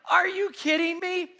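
high, loud, disbelieving shout; exasperated yell. Retold or imitated. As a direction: yell it at the mess in the car. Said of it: "I'm pretty sure I said it out loud".

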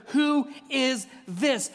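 drawn-out, awed question; slow wonder. Acted. Disciples asking each other in awe, slow. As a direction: stretch it slowly in wonder.

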